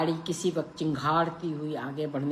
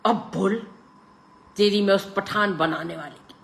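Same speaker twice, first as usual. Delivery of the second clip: louder, raised taunt; bullying shout; 5 semitones higher, 6 dB louder than usual. A narrator's reading. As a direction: crow at him, bullying. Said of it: "बाबू चिल्लाए जा रहा था".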